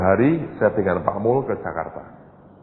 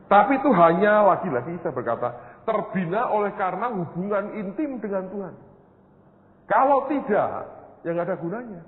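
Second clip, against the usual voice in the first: much higher, louder bursts of sermon climax; fervent preaching; 9 semitones higher, 3 dB louder than usual. Acted. Pastor urging congregation toward intimacy with God, impassioned. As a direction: rise into a passionate exhortation.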